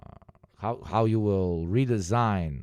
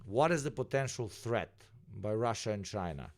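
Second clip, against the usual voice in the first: normal talking voice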